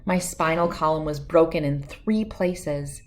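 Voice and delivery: usual speaking voice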